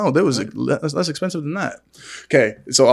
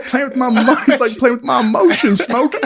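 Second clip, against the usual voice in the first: Big Worm; 10 semitones higher than usual. Retold or imitated. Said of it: "it was a big worm from Friday".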